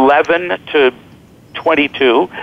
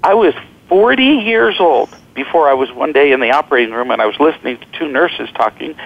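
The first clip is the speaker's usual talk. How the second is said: ordinary talk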